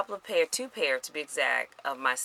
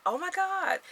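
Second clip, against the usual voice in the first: much higher, an excited squeal of disbelief; retold amazement. Vlogger reliving the weigh-in for viewers, high excited squeal. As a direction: shriek it like you just saw the scale. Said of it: "and I was like".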